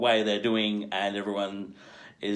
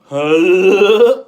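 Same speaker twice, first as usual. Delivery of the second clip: higher, loud startled huh; quirky huh; 7 semitones higher, 12 dB louder than usual. Retold or imitated. Charlize's character blurting her quirk, loud startled huh. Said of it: "her way was like".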